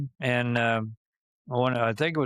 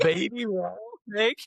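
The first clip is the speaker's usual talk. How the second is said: higher, startled disbelief; shocked echo; 8 semitones higher than usual. Retold or imitated. Friend hears of the lost snake, startled and disbelieving. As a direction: repeat it like you can't believe it.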